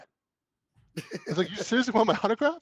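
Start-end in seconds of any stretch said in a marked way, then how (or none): 1.5-2.6 incredulous question through a laugh; laugh, can't believe he's asked; a fan asks for his autograph, he answers laughing; laughing disbelief. Retold or imitated.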